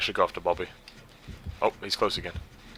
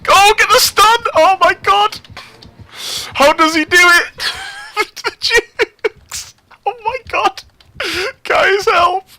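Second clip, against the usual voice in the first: high, loud, breathless cries; frantic excitement; 6 dB louder, slower than usual. Real, not acted. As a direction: keep shrieking through the chase. Frantic chase commentary.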